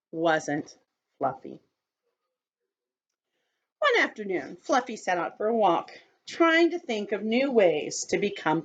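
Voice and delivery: put-on gentle storybook narration for kids; children's story reading. A narrator's reading. Teacher reading a story to children, gently. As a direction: read it to the circle like a picture book. Reads picture book to children.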